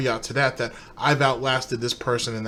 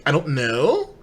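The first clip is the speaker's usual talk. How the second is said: imitated Valley Girl upswing, KNOW sliding way up; high-pitched ending emphasis; slower than usual. Mocking his little phrases, high-pitched emphasis at the end. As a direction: lift KNOW into a high, questioning rise. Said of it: "that high-pitched emphasis".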